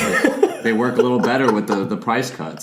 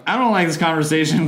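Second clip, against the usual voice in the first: higher, laughing protest; 7 semitones higher than usual. Real, not acted. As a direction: laugh as you object.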